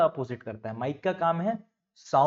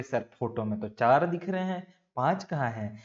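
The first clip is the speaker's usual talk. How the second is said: ordinary talk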